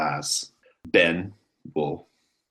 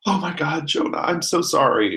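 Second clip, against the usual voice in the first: crying apology; 11 semitones higher, 3 dB louder than usual. Acted. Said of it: "she's like".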